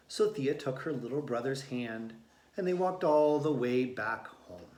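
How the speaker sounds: ordinary delivery, nothing marked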